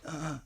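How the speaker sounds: a soft breathy moan, barely voiced; imitating a partner's faint moan; mock little moan. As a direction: give a tiny half-hearted moan. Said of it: "They give you a little moan".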